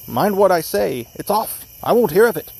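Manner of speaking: high, heated, emphatic refusal; angry cry. A narrator's reading. Young Lee breaking off his sister's engagement, angry cry. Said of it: "cried the other".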